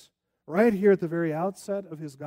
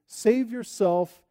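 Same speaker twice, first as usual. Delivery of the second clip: normal talking voice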